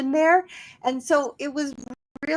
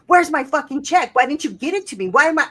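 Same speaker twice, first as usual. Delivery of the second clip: louder, higher mock rage; angry reading; 6 semitones higher, 6 dB louder, faster than usual. Mimicking how the husband heard the text, hammed-up fury. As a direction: spit it out as an angry demand. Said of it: "She's saying".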